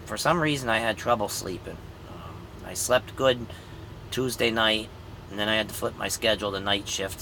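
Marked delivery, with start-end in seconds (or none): none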